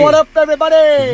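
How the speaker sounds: a very high, very loud yell; screaming hype intro. Pretend. Host screaming hello to his listeners. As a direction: scream it like a wild hype man.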